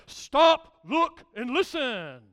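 a touch of a stern coach, loud sharp Stop; booming coach barking orders; coach's deep voice. Retold or imitated. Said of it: "in that deep voice, he would say".

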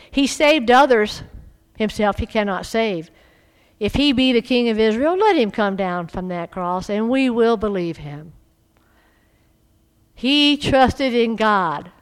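loud, forceful reading of the mockers; loud scripture reading. Preacher reading the crowd mocking Jesus, loud and forceful. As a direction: boom out the scripture.

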